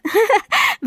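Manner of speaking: short high delighted laugh; little high-pitched chuckle of joy; happy laugh. Acted. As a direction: laugh with pleased surprise.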